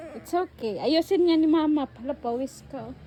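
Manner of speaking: normal talking voice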